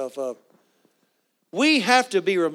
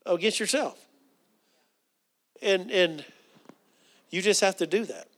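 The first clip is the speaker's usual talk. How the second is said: usual speaking voice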